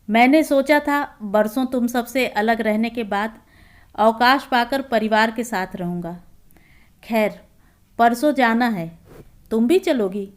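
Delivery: plain reading voice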